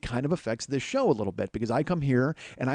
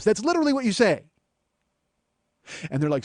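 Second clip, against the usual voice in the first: ordinary talk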